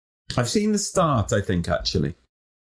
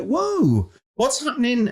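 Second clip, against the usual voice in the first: surprised; 10 semitones higher than usual. Retold or imitated. Reacting to smashed cottage.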